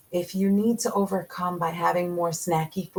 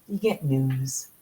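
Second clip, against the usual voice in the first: ordinary delivery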